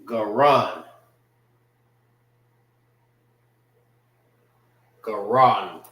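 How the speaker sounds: "Garan" as gah-RAHN; "Garan" rhyming its end with "on"; Hebrew pronunciation. Shown as a demonstration. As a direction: say "Garan" with an ah in the last syllable. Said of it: "how you say throat in the Hebrew".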